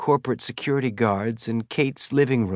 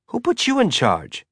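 indignant challenge; 12 semitones higher than usual. Acted. Zadie challenges Kate's authority.

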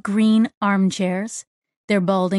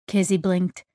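usual speaking voice

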